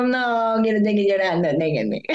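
hammed-up weepy protest; mock crybaby. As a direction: play the crybaby, ending in a laugh. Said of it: "yo le salí re llorona".

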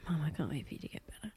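put-on soft breathy whisper of a child's plea. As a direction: whisper it softly, fast. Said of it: "Harla was like".

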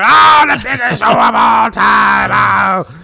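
crazed announcer bellow, very high; drawn-out, over-the-top hype shout; Vince McMahon. Retold or imitated. Podcaster mimics the wild WrestleMania voiceover, bellowing. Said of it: "Vince McMahon is going crazy in the voiceover".

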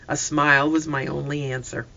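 ordinary delivery, nothing marked

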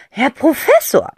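teasing mock title; mock respect. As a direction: play at bowing to him. Aunt teases Zack. (spöttisch respektvoll)

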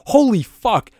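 high, startled exclamation; alarmed reaction. Acted. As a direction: act out sudden shock at danger. Imagines car coming head-on.

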